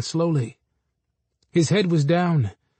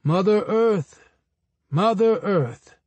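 calling, slow on the second; 4 semitones higher, slower than usual. A narrator's reading. Anansi calling Mother Earth, drawn out call. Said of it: "Anansi called out".

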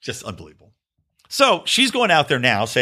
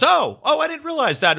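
much higher, sheepish mock surprise; mocking the guest; 12 semitones higher than usual. Host imitating TV guest backing down, high-pitched and sheepish. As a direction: squeak it like a caught-out guest. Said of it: "and he goes".

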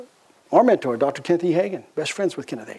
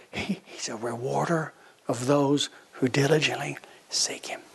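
slow hushed whisper; whispered scripture; 3 semitones lower, 7 dB quieter than usual. Real, not acted. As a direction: whisper the verse slowly, drawing them in. Preacher quotes scripture softly.

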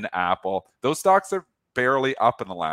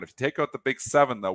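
ordinary delivery

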